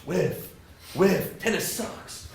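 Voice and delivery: adult student sulking on the tennis court; grumpy adult learner. Retold or imitated. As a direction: play a frustrated grown-up missing shots. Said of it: "Adults go like this".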